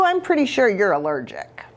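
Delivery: ordinary delivery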